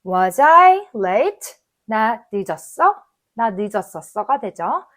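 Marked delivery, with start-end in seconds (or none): 0.0-1.5 very slow with a rising end; slow model question. Shown as a demonstration. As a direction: drag it out so learners follow. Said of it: "같이 하는 걸로 해볼게요". (따라 하도록 천천히 읽는 의문문)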